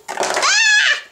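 very high squeal of a falling toy; pretend scream. Toy thrown on the floor.

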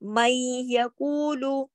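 "मई यकूलु" slowly, noon merged into a held "y"; idgham demonstration. Shown as a demonstration. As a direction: draw out "मई यकूलु" with the merged sound held long. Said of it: "इसको कहते हैं इदगाम".

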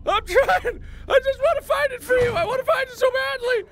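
very high, loud mock bawling; hammed-up sobbing shriek; exaggerated breakdown. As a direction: wail it out in pretend tears. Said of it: "be broken down into tears".

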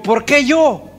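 high, pleading protest; Moses' protest. Retold or imitated. Moses protesting to God, high and pleading. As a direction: beg to be let off.

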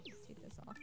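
ordinary talk

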